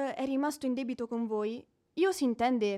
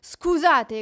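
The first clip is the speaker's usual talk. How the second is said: sharp, much louder rebuke; indignant; 8 dB louder than usual. Acted. Widow rebukes rude creditor, indignant and loud. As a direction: snap back, offended.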